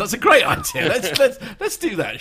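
sarcastic. Acted. Teasing host about insulting guests.

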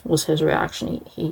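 usual speaking voice

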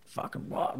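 whispered disbelief; barely voiced, as if floored by the caller; hushed, stunned retort under the breath. Stunned reaction to the famous caller.

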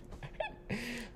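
a sudden high burst of laughter that falls away; quick high giggle that trails off. Real, not acted. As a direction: laugh out, then let it drop. Laughing after friend's quote.

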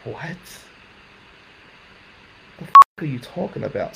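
shocked disbelief. Retold or imitated. Shock at the news.

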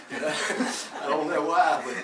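chuckling as he tells it, amused; laughing. Real, not acted. Laughing while telling story.

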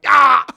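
high loud yelp on landing; pained yelp. Retold or imitated. Diving onto the bus step, sudden loud yelp. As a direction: cry out as you hit the step.

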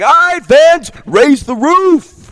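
high, loud, slow hammed-up arena call; mock hype MC. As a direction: ham up a cheesy arena announcer. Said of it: "and I'm like".

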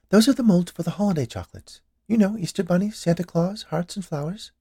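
high, lilting woman's voice; helpful confectioner. Acted. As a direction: list them obligingly in a high pitch. Confectioner shows her molds.

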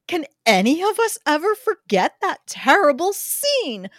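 ordinary talk

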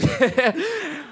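high giggling cackle; gleeful high snicker; acted laughter. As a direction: laugh at your roommate's horror. His reaction to roommate's scream.

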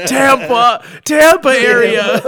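high, loud, stretched place names; comic shouted answer, drawn out; hammed-up guessing. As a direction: blurt it out in mock excitement. Joins in shouting mock guesses.

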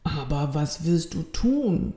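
softly worried question; understated concern, voice drawn back; slightly uneasy. A narrator's reading. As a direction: ask cautiously, a touch of unease. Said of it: "nun doch etwas beunruhigt".

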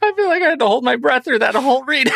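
high, quick words through real laughter; cracking up. Real, not acted. Host admitting to the co-host the read was a struggle, laughing. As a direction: blurt it out while laughing hard.

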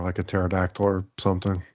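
usual speaking voice

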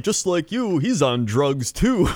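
high, slow drawn-out tagline; radio ad pitchman. Retold or imitated. Comic quoting the insurance ad to his friend, high slow announcer. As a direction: stretch the punchline like an ad announcer. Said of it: "they got the one".

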